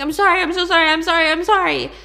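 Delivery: high, loud frantic apologies; freaking out. Retold or imitated. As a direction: babble sorry in panic. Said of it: "he's freaking out".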